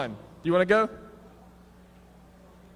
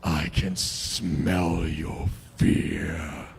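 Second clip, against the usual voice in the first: low, slow menacing taunt; creepy killer; 10 semitones lower, much slower than usual. Acted. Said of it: "I'll be the bad guy or the serial killer".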